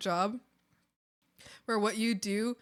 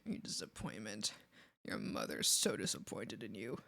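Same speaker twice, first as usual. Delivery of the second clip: much lower and much softer, a cold put-down; scornful scolding; 13 semitones lower, 13 dB quieter than usual. Retold or imitated. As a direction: drop low and shame him. Scolding the scammer about mother.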